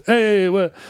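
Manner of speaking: high, hammed-up New York accent; New Yorker accent. New Yorker customer answering the newsstand clerk, in a New York accent. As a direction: protest like a caricature New Yorker. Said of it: "they'd walk in and they'd go".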